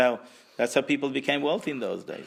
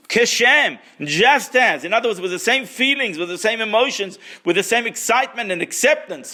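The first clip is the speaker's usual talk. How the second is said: higher and louder, driving the point home; preaching emphasis; 10 semitones higher, 8 dB louder than usual. Real, not acted. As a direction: preach it with rising fervour. Preacher stressing key idea.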